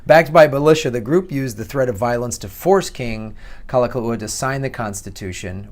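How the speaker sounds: ordinary talk, nothing marked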